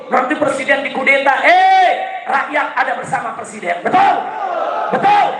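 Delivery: high-pitched, driving political oratory; rousing speech building to shouted slogans; fiery rally preaching. Real, not acted. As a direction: whip the crowd into chanted answers. Preacher rallies the crowd.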